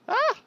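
short, sudden cry of fright; startled yelp. Real, not acted. Player spots a spider, quick startled yelp.

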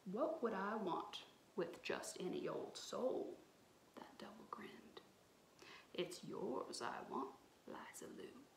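tricky swamp devil whispering his want; sly devil whisper. Acted. Devil grins, hushed.

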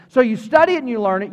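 ordinary talk

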